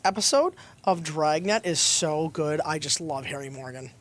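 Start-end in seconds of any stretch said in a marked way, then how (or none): none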